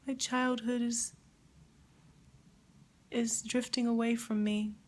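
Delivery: grieving. Real, not acted. Grieving a musician's death.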